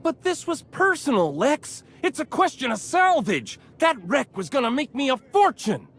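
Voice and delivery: high-pitched, aggrieved pleading; indignant protest. Acted. Salvager pleading his wreck claim to the bar owner, indignant. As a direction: argue back, worked up.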